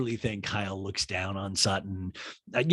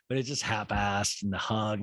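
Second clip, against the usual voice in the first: ordinary talk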